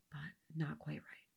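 a rueful whispered aside. Real, not acted. Teacher sighing over Eve's near miss, whispered. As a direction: drop to a sorry whisper.

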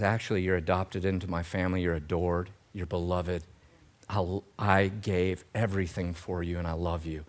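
low, very soft voice of a loving God; hushed, fatherly comfort; tender reassurance. Acted. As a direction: reassure gently, almost under the breath. Voicing God reassuring the believer.

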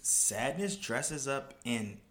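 slow, questioning, lifting at each end; sceptical rereading. Real, not acted. Picking apart a tweet he calls BS, incredulous. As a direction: read it back slowly as if it makes no sense.

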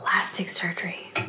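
hushed whisper of Plastic surgery. Acted. Mocking the word plastic surgery.

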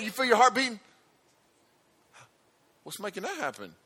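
normal talking voice

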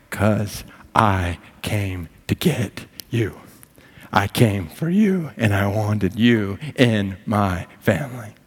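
slow, deliberate reassurance; God's tender answer. Acted. God answering a doubting child, tender and deliberate. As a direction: give each word room, gently insistent. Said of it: "He looks upon your life and says".

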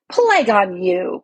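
a king cursing, drawn out for weight; cried out. A narrator's reading. Furious king cursing the lord who advised against the duel, slow loud cry. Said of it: "cried Miraz".

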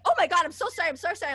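high, loud flustered sorry; mock mortified doctor; flustered apology. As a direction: gush apologies in embarrassment.

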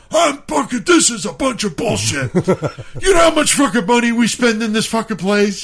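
high-pitched retold shouting; outraged mock scream. Friend berating the manager, mock screaming. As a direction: rant like a theatrically outraged customer. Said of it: "'cause he's screaming".

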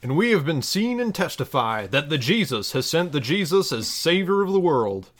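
measured, solemn Bible reading; scripture reading. Acted. Reading a parody Bible passage to listeners, solemn scripture voice. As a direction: read it like a pulpit lesson.

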